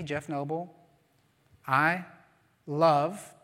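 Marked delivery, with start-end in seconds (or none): 1.7-3.3 "I" and "love" set apart by pauses; stress on each word. Shown as a demonstration. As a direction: give "I" then "love" each its own firm beat. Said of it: "you emphasize each different word".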